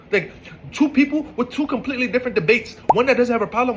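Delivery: you can't believe this guy; high pitched frustration; exasperated rant. Real, not acted. Ranting about the pointless argument.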